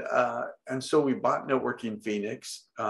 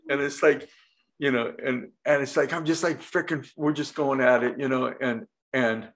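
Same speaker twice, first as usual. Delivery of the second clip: ordinary talk, nothing marked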